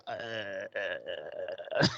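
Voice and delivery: low, soft gagging uh uh uh; retold disgusted groan. Retelling Giles touching blood, a low mock groan. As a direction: mimic a man too grossed out to speak. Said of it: "he goes".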